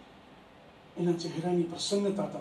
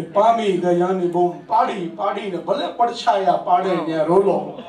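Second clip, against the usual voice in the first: raised, sing-song reciting voice; poetry recitation; 6 dB louder than usual. Acted. Poet reciting his verse to an applauding audience, raised and sing-song. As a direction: declaim each line to the hall, savouring it.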